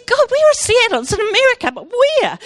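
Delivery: startled cry of where is Seattle; astonished. Retold or imitated. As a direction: ask God in high disbelief. Astonished question to God.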